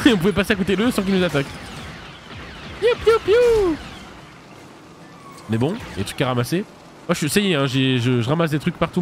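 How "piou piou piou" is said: high mock laser shots; playful high blaster sound effects; blaster noises. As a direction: pew pew like a toy blaster.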